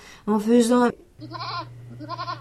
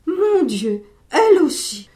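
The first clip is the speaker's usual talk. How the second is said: higher and louder, a shocked outcry; stunned cry; 6 semitones higher, 6 dB louder than usual. Acted. Monsieur Seguin crying out, stunned by his goat. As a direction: cry out in dismay. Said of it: "cria monsieur Seguin stupéfait".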